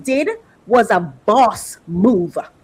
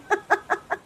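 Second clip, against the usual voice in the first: quiet, high little giggle; brief bashful laugh; soft giggle; 9 semitones higher, 7 dB quieter than usual. Real, not acted. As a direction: giggle shyly. Giggles about her man.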